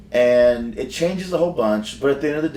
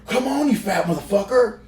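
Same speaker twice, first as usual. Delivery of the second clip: imitated angry taunt; 8 semitones higher than usual. Retelling X-Pac insulting him.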